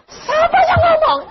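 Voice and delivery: high laughing shout of disbelief. Retold or imitated. Preacher retorts to rumor.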